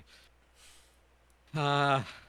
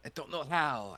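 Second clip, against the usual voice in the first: higher voice; 8 semitones higher than usual. Shown as a demonstration. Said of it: "a high pitch".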